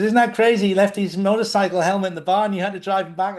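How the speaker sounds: wife buzzing down the phone; retold wife excitedly on the phone. Wife phoning, voiced by teller.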